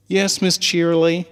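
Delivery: a doubtful little pony teasing her friend; high filly voice. Acted. Scootaloo doubting Apple Bloom's speech, high and skeptical. Fillies in unison.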